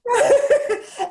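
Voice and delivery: high delighted laugh; bright falling laugh; high burst of laughter. Real, not acted. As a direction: laugh along with her. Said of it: "it makes me laugh".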